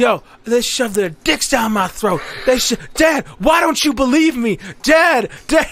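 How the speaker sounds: high, loud, desperate mock shouting, rising as it goes; patient yelling to his dad. Patient phoning his dad, claiming abuse, high pleading shout. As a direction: yell for Dad like a panicked kid nobody believes. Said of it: "He's like".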